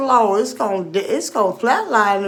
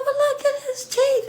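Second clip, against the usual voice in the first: high mocking quote; 12 semitones higher, 4 dB quieter than usual. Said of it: "I said".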